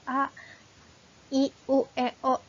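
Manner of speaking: A, I, U, E, O each pronounced distinctly and separately; clear vowels. Shown as a demonstration. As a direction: enunciate A, I, U, E, O one by one, as a clear articulation demo. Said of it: "Huruf vokal itu harus jelas".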